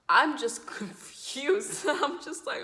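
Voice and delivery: high, loud, laughing through the words; laughing confusion. Real, not acted. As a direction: draw it out while cracking up. Laughing at her own confusion.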